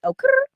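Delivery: high, loud rolled R; playful trilled catchphrase. Pretend. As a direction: roll the R in a showy high squeal. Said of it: "Oh, let me do it".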